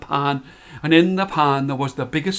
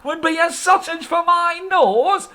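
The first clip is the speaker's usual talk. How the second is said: higher, slow pleading whine; much higher whining husband; whining old man; 13 semitones higher than usual. Acted. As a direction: plead pitifully. Old man protesting to wife.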